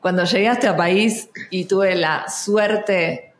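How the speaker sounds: a light Argentine accent, slow, with "llegué" said with a sh sound; hint of Buenos Aires Spanish, unhurried. Shown as a demonstration. Actress telling her arrival story in a light Argentine accent. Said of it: "voy a empezar en argentino".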